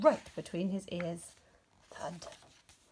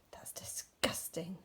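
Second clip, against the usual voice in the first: slow unvoiced disgusted whisper; appalled hushed disgust; 3 semitones lower, 8 dB quieter, slower than usual. A narrator's reading. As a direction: recoil and whisper it slowly. Rabbit appalled at the idea.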